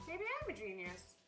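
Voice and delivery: ordinary delivery, nothing marked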